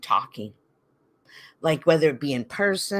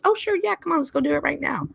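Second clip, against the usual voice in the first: much higher, gushing mock agreement; mock overeager yes; 8 semitones higher, faster than usual. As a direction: play the too-eager helper, high and quick. Mocking an overeager imagined helper.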